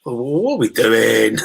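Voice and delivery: bewildered. Retold or imitated. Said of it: "just looking at each other going".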